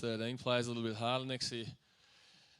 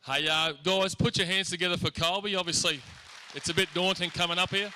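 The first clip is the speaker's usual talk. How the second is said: loud, raised emcee call to the crowd; MC announcement; 5 semitones higher, 7 dB louder than usual. Acted. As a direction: get the hall clapping. MC rallying the school audience.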